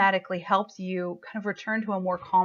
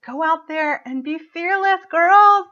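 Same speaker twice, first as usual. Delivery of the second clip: slow build, climbing high and loud; rousing send-off; 10 semitones higher, 8 dB louder, slower than usual. Real, not acted. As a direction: cheer them on, louder towards the last words. Rallying send-off to the women.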